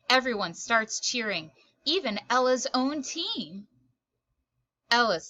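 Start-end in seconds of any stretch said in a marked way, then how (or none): none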